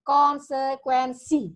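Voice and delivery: "Konsekuensi" slow and loud, syllable by syllable; clear articulation. Shown as a demonstration. Vocal coach training students' articulation, exaggeratedly clear pronunciation. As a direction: stretch out each sound of "Konsekuensi". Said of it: "melatih kejelasan pengucapan huruf dan kata".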